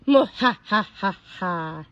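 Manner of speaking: slow villainous cackle; hammed-up evil laugh. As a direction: cackle like a cartoon baddie.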